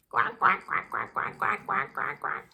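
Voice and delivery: soft, whispered little quacks; tiny ducklings peeping. Acted.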